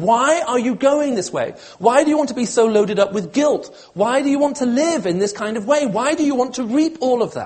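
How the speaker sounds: high, loud, urgent appeal; impassioned pleading. Acted. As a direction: implore them, hammering each repeated phrase. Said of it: "God is pleading with his people here".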